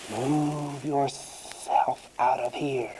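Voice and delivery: slow, drawn-out snake voice; big snake. A narrator's reading. Big snake ordering the pig away, slow and menacing. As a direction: stretch the sibilants like a snake. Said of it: "says a big snake".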